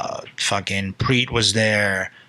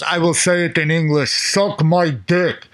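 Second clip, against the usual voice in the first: put-on voice, higher, slowing to the end; Murillo's retort; 6 semitones higher, slower than usual. Coach answering a heckler at the match, put-on drawn-out retort. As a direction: imitate a friend, stretching the last words. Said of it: "And then Murillo goes".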